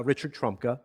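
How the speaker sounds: ordinary talk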